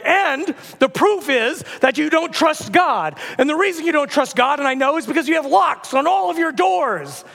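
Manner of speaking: high, declaimed accusation; ranting prophet. Acted. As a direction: build the case like a zealous street prophet. Acts out prophet's rant.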